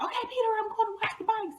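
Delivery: high, quick mock call to a brother. Telling brother she's off to wash the bikes, calling out. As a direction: call it out high and hurried, as if across the house.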